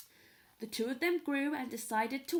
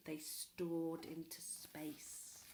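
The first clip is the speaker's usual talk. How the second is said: soft, slow whisper; hushed narration; 7 semitones lower, 11 dB quieter, slower than usual. A narrator's reading. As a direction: breathe it out slowly. Narrator hushes the rocket flight.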